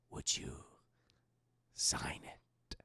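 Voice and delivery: very soft whisper; whispered temptation, split by a long pause; sinister other voice. Retold or imitated. An unseen voice asks Catherine to sign, in an eerie whisper. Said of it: "we hear this other voice in the room".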